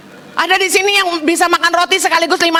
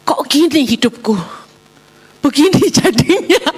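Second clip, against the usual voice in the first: hammed-up woeful self-pity; retold misery played for comedy; mock sadness. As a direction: lament your life, half laughing. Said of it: "saya sedih".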